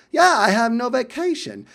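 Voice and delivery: light Essex impression; mock Essex accent. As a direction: insist like an Essex colleague. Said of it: "that awesome English accent".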